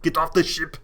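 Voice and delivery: mock Viking captain barking at his crew.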